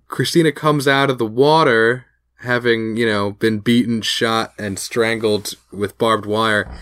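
ordinary delivery

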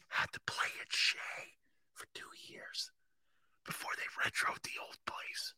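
very soft, breath-only whisper. Real, not acted. Fan telling old stadium history, in a whisper. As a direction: whisper it as if it were a secret. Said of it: "I have no idea why I'm whispering".

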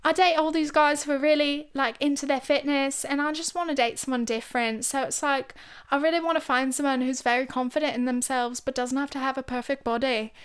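light put-on British accent. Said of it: "the accent is fucking horrendous".

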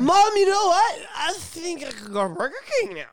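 put-on high, giddy wasted-kid voice; mock drunk kid. Drunk kid telling his mom he wants Burger King, high giddy mock voice. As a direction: play a drunk teen bragging to mom.

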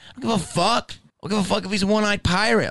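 high-pitched, dismissive rant; losing it. Retold or imitated. Dismissing a mother's excuse, losing it. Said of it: "And I just lost it".

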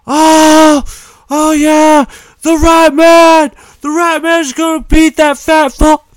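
high-pitched, full-force crowd yelling; roaring crowd. Acted. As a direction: cheer wildly like a rowdy fight fan. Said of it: "you hear the crowd sorta go".

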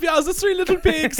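high, loud mock German accent; German cartoon pig. Co-host imitating Shrek's pigs, high loud German accent. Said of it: "The pigs in Shrek are German".

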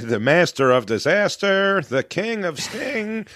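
drawn-out mock hype intro, rising high at the end; lightly hammed-up ring announcer. Guest jokingly hypes himself after the intro, mock announcer.

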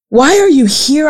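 demanding. Acted. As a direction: press him for an answer. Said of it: "I demanded".

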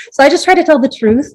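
ordinary talk

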